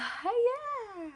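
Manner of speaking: short high-pitched giggle; a quick bright laugh. Real, not acted. Laughs while starting story.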